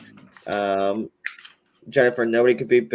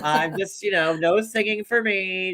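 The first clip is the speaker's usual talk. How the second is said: higher-pitched coy imitation; imitated bashful demurral, pitched up; mock false modesty; 7 semitones higher than usual. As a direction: play humble in a put-on high voice. Continues mimicking Melissa's false modesty.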